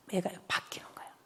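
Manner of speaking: dropped to a breathy whisper for the turning point; hushed whisper. Real, not acted. 강연자가 청중에게 아이가 변한 순간을 속삭이며. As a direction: whisper it like a secret. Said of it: "lecturer hushes the turning point".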